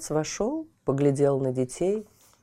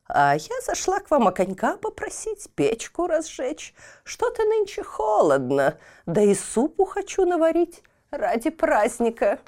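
put-on a tiny old crone asking; old woman voice; 11 semitones higher than usual.

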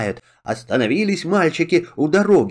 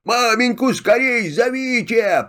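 calling out; 6 semitones higher than usual. Acted. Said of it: "Кричит сыновьям".

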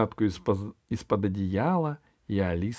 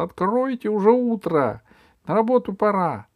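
high, raised wake-up call; 12 semitones higher, 6 dB louder than usual. Acted. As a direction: call through a window to a sleeper. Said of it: "позвала Алиса". (громкий оклик спящему)

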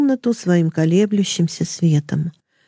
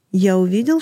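ordinary delivery

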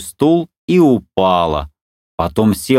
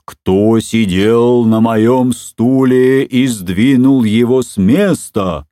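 slow, louder papa bear growl; frightening roar; 2 semitones higher, 3 dB louder, slower than usual. A narrator's reading. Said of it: "зарычал страшным голосом". (страшный рёв Михаила Ивановича)

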